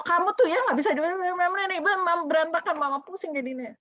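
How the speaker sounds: high-pitched fed-up mom's rant; annoyed scolding. Acted. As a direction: scold like an exasperated mother. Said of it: "udah marah-marah, udah kesel".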